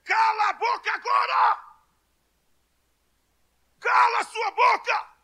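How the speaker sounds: high, forceful yell to silence someone; re-enacted furious shout; shouted rebuke. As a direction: bellow an outraged command, high in the voice. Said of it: "eu gritei, eu esbravejei".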